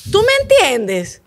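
drawn-out loud ¿Tú me entiendes?; emphatic appeal. Real, not acted. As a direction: stretch it out to make her feel it. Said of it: "emphatic appeal to listener".

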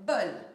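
bol said loud as one punched syllable; one clear beat. Shown as a demonstration. Teacher counting one syllable in bol for the children. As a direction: clap out bol as a single beat. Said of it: "Elle s'arrête à une syllabe".

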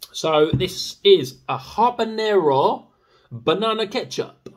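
slow, high, loud put-on announcer voice; silly presenter voice. Reviewer introducing the ketchup in a slow, hammed-up announcer voice. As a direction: present it like a grand TV host. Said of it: "Don't know why I'm talking like that".

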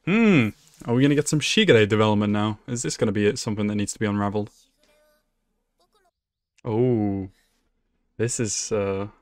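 ordinary talk, nothing marked

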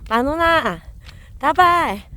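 loud high name calls; calling dogs by name. Real, not acted. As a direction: call each dog's name across the field.

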